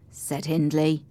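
plain reading voice

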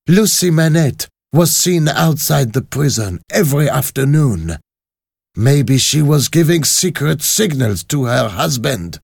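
high, forceful, ruthless leader; vengeful revolutionary woman. Acted. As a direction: declare a death sentence to conspirators. Voices Madame Defarge.

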